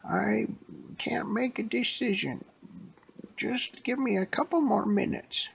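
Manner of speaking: put-on high-pitched old lady voice. Old lady stalling police from inside the store, high-pitched old lady voice. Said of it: "She's just an old lady".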